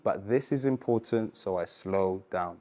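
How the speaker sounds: slow, soft and deliberate for the important bit; slow right down to mark it as important. Shown as a demonstration. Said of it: "you slow down".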